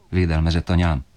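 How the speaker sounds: usual speaking voice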